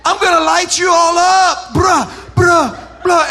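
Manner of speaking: high, shouted mock kid threat; imitating the boy threatening the group; cocky middle schooler. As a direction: brag like a Wild West kid. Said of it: "He's like".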